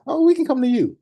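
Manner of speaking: mock the overkeen salesperson; eager wrong answer. Shown as a demonstration. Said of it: "listen to how I, I feel this question".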